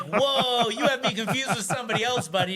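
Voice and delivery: startled, defensive pushback; taken-aback protest. Retold or imitated. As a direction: back off in alarm, retelling it. Protesting a stranger's shouted accusation.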